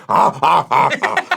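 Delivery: loud, rising repeated grunts; Tim Allen grunts. Retold or imitated. Replying to his wife with loud grunts. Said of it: "I've been doing it a lot".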